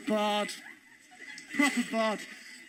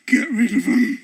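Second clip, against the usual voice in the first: louder, urgent order; urgent hiss; 4 semitones lower, 6 dB louder than usual. Retold or imitated. Comedian urges his friend to get rid of the queue, louder. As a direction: push him away quickly. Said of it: "I was going".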